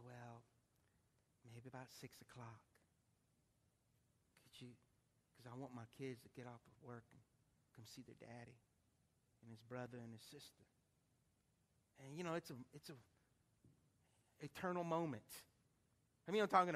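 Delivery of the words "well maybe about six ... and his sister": low, very soft, halting; subdued grief. Retold or imitated. Family member tells doctor when to unplug him, subdued. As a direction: answer quietly with long pauses, voicing her.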